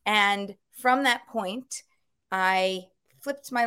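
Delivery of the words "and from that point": ordinary delivery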